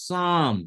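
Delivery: সাম as one drawn-out syllable, P left silent; silent P pronunciation. Shown as a demonstration. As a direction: say সাম starting on the S, no P heard. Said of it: "চাপা পড়ে থাকছে".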